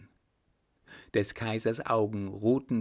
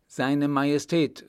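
loud, higher announcement; announcing call; 5 semitones higher, 6 dB louder than usual. A narrator's reading. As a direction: call it into the room. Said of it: "rief er hinein".

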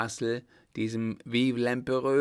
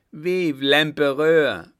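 loud, high, drawn-out crowd cheer; full-voiced rallying roar; 5 semitones higher, 12 dB louder, slower than usual. A narrator's reading. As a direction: bellow it out slowly as one crowd. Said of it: "brüllten alle".

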